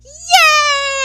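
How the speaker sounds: high, loud, long-held cheer; mock cheer. Student cheering the return of school, hammed-up cheer. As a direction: stretch out a big hammed-up yay.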